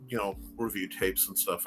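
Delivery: ordinary delivery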